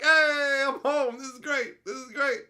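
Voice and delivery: hammed-up delighted greeting; cheerful arrival. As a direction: burst in like coming home. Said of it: "and they're like".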